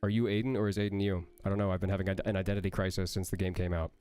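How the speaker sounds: usual speaking voice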